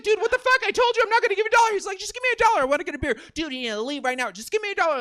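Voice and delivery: high, loud heated back-and-forth; angry shouting match. Retold or imitated. Comic and beggar shouting over a dollar. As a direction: yell like it's escalating. Escalating argument quoted.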